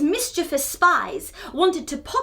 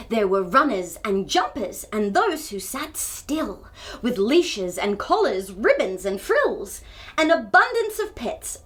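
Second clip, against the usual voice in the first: usual speaking voice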